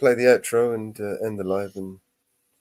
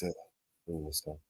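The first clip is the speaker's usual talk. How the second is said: ordinary delivery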